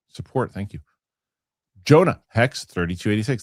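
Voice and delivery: ordinary talk, nothing marked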